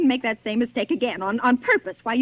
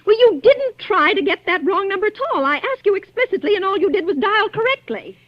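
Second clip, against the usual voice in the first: exasperated scolding; 7 semitones higher than usual. Acted. Caller scolds the operator.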